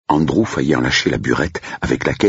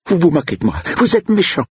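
high, louder, wounded reproach; hurt cook; 3 dB louder than usual. Acted. Cook scolding Blake for mocking her, high hurt voice.

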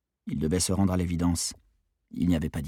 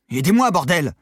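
louder and higher, swearing in frustration; frustrated shout; 10 semitones higher, 8 dB louder than usual. A narrator's reading. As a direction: bark for help at the stuck trapdoor. Maddy desperate, trapdoor won't move. (cri exaspéré, plus fort et plus aigu)

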